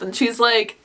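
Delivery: ordinary delivery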